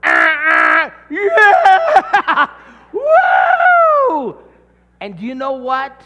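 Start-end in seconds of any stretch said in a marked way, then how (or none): 0.0-4.4 very loud, high whooping cheers; passing drivers cheering the spanking, shouted; cheering passersby. Retold or imitated. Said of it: "Cars are passing us going".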